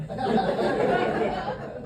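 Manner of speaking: breathy high mocking laugh; dad scoffing at the idea.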